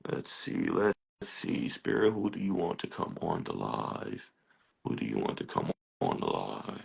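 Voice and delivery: soft, low murmur to spirit; quiet asking spirit. Real, not acted. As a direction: hush and ask the spirit who comes next. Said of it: "asks spirit quietly".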